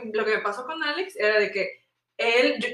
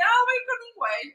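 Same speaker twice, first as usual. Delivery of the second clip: mock carefree voice; 11 semitones higher than usual. Friend mocks Pris's casual reply.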